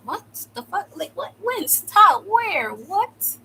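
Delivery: high-pitched bewildered questions; acted-out stunned reaction; retold shock. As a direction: blurt it out in mock disbelief. Retold shock at seeing him bleeding.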